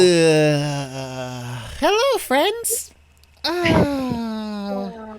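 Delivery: high, slow, sleepy greeting; sleepy high hello; groggy waking yawn. Acted. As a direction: stretch out a just-woken hello, high and drawn. Dudley waking up in underwear.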